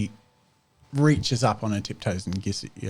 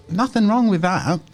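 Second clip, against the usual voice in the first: higher, a little louder, mock-innocent; [name]'s voice; 9 semitones higher, 4 dB louder than usual. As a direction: [name] shrugs off the rebuke about the nickname, higher voice. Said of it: "says [name]".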